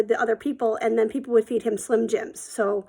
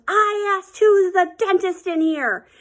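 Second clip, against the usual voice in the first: high, slow, insistent shout; angry dad yelling; 8 semitones higher, 4 dB louder, slower than usual. Retold or imitated. Dad demanding an answer from the whole bar, yelling. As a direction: repeat the demand, drawing out every word. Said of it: "he yells".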